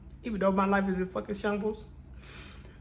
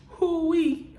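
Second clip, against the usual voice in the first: crying hoo-wee; 7 semitones higher than usual. Real, not acted. Breaking down before viewers with a high choked cry. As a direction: let it break out as a sob.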